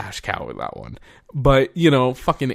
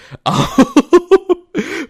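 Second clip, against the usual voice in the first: loud, high burst of real laughter; big laugh; 9 dB louder than usual. Real, not acted. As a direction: laugh hard at yourself. Laughs after pulling up calculator.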